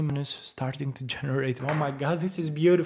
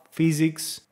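ordinary talk, nothing marked

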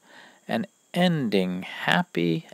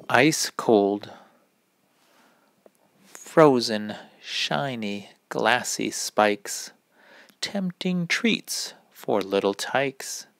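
put-on lilting poem for kids; rhyme reading. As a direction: gently read the winter rhyme. Reads seasonal rhyme to kids.

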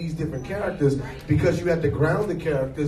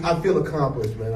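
ordinary talk

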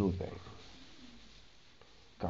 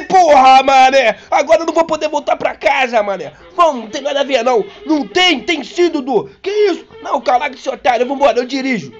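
higher, louder frantic bickering; loud argument; 9 semitones higher, 7 dB louder than usual. Retold or imitated. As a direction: argue back in a panic. Heated panicked back-and-forth argument.

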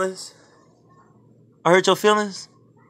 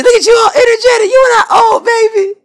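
excited pet squeal; 7 dB louder than usual. Real, not acted. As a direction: squeal it way up high and loud to cheer the dog up. Cheering up moping pet.

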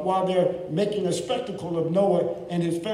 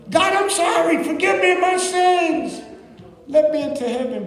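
high, loud desperate begging; pleading sinner; 12 semitones higher, 6 dB louder than usual. Acted. Lost sinner begs God at judgment, high and loud. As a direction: beg God for mercy.

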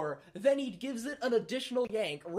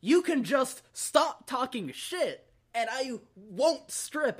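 slow, emphatic, higher-pitched standoff; retold firm refusal; 5 semitones higher, slower than usual. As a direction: lay down terms, dragging out each word.

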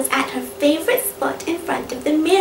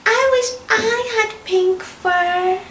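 high, slow wishful pup; dreamy little puppy longing; young poodle voice; 6 semitones higher than usual. Acted. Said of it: "said a young poodle".

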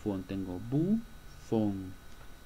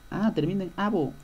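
mock realization; 8 semitones higher, 5 dB louder than usual. Teacher acting student realizing.

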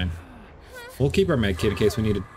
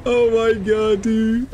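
laughing; 10 semitones higher, much slower than usual. Real, not acted. Laughing at teammate's play.

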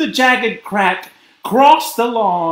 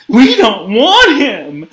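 louder, higher, slow protest; indignant retort; 7 semitones higher, 6 dB louder than usual. A narrator's reading. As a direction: push back slowly and loudly. Missus Torkelson's retort to mother.

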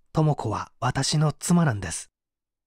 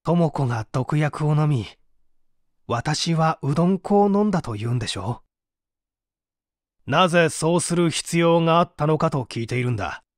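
ordinary talk, nothing marked

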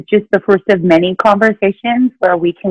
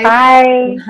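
after crying; 7 semitones higher, 4 dB louder than usual. Real, not acted. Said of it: "again, a little teary".

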